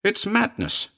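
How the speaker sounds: very high protest; high-pitched woman's outburst; alarmed cry. A narrator's reading. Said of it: "she cried".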